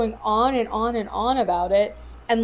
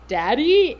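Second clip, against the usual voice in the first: "Daddy" louder and higher, imitating his outburst; retold cry of "Daddy", pitched up; mock scream; 5 semitones higher, 5 dB louder than usual. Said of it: "when he screams".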